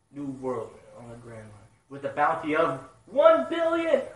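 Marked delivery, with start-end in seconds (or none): 1.9-4.0 loud, high and slow, drawing out the number; excited announcement. Real, not acted. Fan reading a shocking bounty, loudly amazed. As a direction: announce it like a big reveal.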